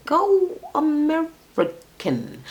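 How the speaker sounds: "American" broken into drawn-out syllables, high; slow emphasis. Shown as a demonstration. Host urging him off foreigners, "American" slowly stretched out.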